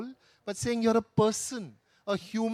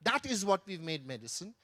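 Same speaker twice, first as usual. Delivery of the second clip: normal talking voice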